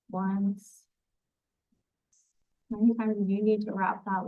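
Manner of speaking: unhurried, even grounding-meditation delivery; guided meditation. Acted. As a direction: guide them gently through a visualization, phrase by phrase. Leads a grounding visualization.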